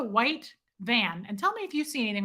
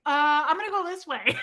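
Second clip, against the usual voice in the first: sheepish retreat; 5 semitones higher, 3 dB louder than usual. Retold or imitated. Backing down, caught.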